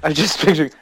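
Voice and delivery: ordinary delivery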